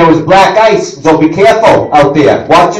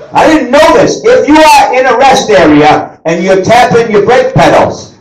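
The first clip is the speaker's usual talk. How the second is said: ordinary talk, nothing marked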